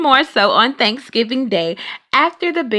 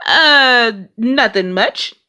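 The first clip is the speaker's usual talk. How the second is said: sheepish; slower than usual. A narrator's reading. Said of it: "Papa said".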